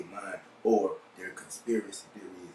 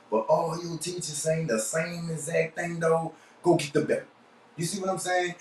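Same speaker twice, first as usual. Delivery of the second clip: lightly voiced mama, pitched higher; mama's scolding; 7 semitones higher than usual. Mama to a child who cut up in school, mild scolding. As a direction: raise the pitch like a mother laying down the law. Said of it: "like my mama used to say".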